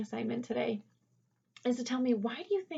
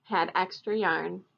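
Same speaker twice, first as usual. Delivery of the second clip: lively read-aloud for a class, louder than her chat; storybook reading; 8 dB louder than usual. A narrator's reading. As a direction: read it to the kids with bright expression. Teacher reads picture book aloud.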